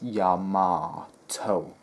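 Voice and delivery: Yamato as 'yah-MAAH-toe', drawn out slowly; English-American pronunciation. Shown as a demonstration. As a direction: stretch the middle of Yamato into a long 'MAAH'. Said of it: "That's how it's pronounced".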